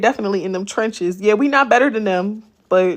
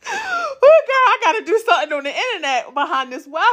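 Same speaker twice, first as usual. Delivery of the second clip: laughing; 9 semitones higher than usual. Real, not acted. Laughing at being too scared.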